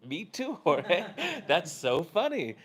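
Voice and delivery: high, loud, laughing reply; half laughing. Retold or imitated. Passenger answering the driver's hysterical remark, half laughing. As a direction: crack up while retelling your amused answer. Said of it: "half laughing".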